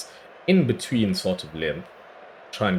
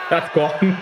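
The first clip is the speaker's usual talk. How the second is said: higher, rising, thrilled; excited cheer; 8 semitones higher, 4 dB louder than usual. Real, not acted. Gamer cheering a wicket, excited higher cry.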